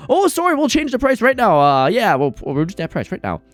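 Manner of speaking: mock panic, starting high then settling; flustered backpedaling. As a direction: imitate a caught-out insurer hastily giving in. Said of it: "And they go".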